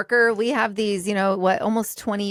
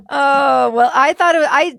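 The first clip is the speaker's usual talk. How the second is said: laughing through the words, louder; giggling while explaining; 4 semitones higher, 6 dB louder than usual. Real, not acted. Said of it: "gonna have me giggling".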